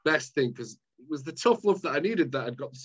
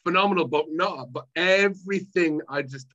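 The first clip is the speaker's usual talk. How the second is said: ordinary delivery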